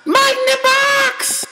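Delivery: very high shouted mascot cry; cereal mascot. Acted. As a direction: shriek the slogan like a mascot. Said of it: "mascot shout".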